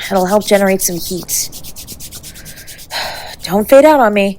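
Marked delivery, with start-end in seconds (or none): 3.4-4.4 urgent plea. Acted. Partner fading in the cold.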